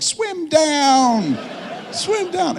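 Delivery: very high, long-held shout; loud, stretched mock kid's cry; yelling. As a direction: wail it out like a kid in a crowd. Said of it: "She started yelling".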